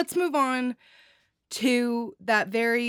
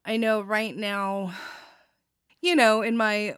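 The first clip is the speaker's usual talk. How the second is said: ordinary talk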